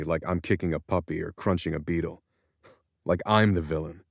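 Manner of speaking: ordinary delivery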